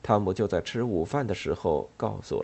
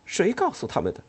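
sharp angry question, pitched up; 9 semitones higher than usual. Acted. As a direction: demand it angrily. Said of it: "他生气地说".

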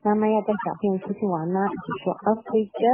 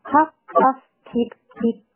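louder, higher puffs, then short peeps; train sound imitation; 5 semitones higher, 4 dB louder than usual. Shown as a demonstration. Teacher imitates the train for children, punchy bursts. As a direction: pop each word like an engine puffing. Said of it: "模仿这个火车的声音".

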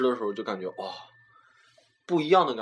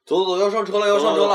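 hurried shout; 8 semitones higher, 6 dB louder than usual. Acted. Rushing friends to catch bus.

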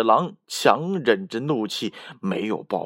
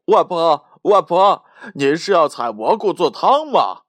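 calling out; 3 semitones higher, 6 dB louder than usual. Acted. Little bear calling to grandma in the forest, cheerful loud call. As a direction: bear cub calling louder from a distance. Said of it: "小熊在叫他".

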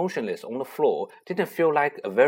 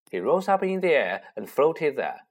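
plain reading voice

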